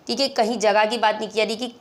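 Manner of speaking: normal talking voice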